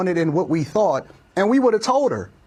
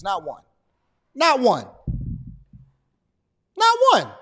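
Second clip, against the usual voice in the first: building emphatic repeats. Real, not acted. Repeats rising to high.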